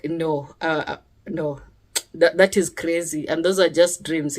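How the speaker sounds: a beaten-down husband mumbling a tired no; weary dismissive husband. Retold or imitated. Husband dismisses her dream, low and soft, tired.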